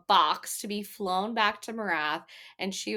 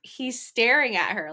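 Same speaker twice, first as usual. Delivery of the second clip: usual speaking voice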